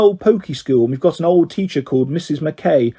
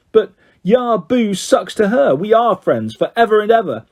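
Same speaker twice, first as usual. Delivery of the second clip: put-on childish defiance; 6 semitones higher than usual. A narrator's reading. Girl defying mum over best friend, childishly defiant.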